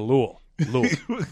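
Louisville squashed to LOO-ul; mock local pronunciation. Doubting the king was called that, Louisville squashed to "Lool". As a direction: swallow the middle of Louisville, "Lool". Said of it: "his name wasn't pronounced Louisville".

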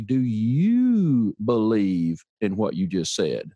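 "you" drawn out long with a swooping rise; stress on YOU. Retold or imitated. Lady challenging the man on his own philosophy, long stressed YOU. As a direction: lean hard and slow on the word "you". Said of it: "And she said that "you" like that".

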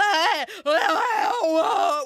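loud, high nonverbal wail; mock a deranged, wordless scream; imitated deranged screaming. Host mimics the killer's screaming, deranged howl. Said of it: "that deranged screaming".

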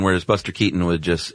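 ordinary talk

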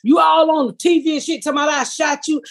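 mock shout. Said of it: "he gets to my".

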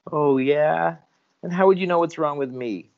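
high, fast, defensive voice; suspicious retort. Acted. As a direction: snap back, quick and suspicious.

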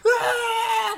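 high, loud excited scream; freaking-out yell. Retold or imitated. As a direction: let out a reenacted celebratory yell. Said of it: "like, yelling on the phone".

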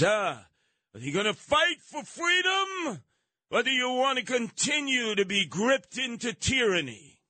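high, stirring rallying cry; rally the troops for freedom; rousing Braveheart speech. Retold or imitated. Host quoting Braveheart, high stirring rallying cry. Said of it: "He says".

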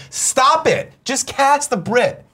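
high-pitched, exasperated plea; exasperated outburst. Real, not acted. Host ranting about a terrible British accent, exasperated. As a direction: burst out in a high rant, drawing out the last words.

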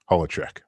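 Holacek in three syllables, HOLL-a-check; reclaimed original pronunciation. Shown as a demonstration. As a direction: say Holacek with a short middle vowel. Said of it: "now it is pronounced".

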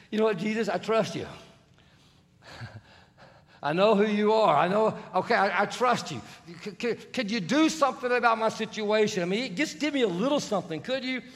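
high, loud, pleading to Jesus; pleading woman. Acted. Woman pleading with Jesus, high and loud. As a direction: beg with nervous trust. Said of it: "she even comes to him and says".

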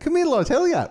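squeaky hammed-up Irish beckoning; Dublin phrase. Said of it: "I hear Damon Clark saying that".

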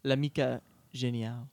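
Italian title said with a French accent; accidental French accent. Real, not acted. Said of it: "Sorry, that was a French accent".

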